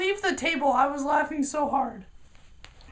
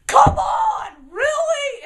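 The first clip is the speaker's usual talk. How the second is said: wailing, high, loud "come on, really"; hammed-up crying outburst; huge scene; 8 semitones higher, 7 dB louder, much slower than usual. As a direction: throw a mock tantrum at the lunch lady. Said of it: "I just make a huge scene".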